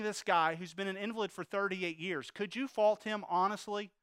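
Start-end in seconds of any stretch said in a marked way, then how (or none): none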